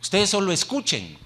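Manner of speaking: ligera imitación de quien manda, más agudo; autoridad imitada. Acted. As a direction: imita una orden tajante al público, algo más fuerte. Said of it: "es como quien dice". (imitando una orden autoritaria)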